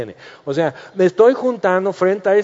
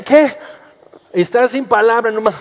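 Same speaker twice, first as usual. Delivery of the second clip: mock shock; 5 semitones higher, 4 dB louder than usual. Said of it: "Y dicen".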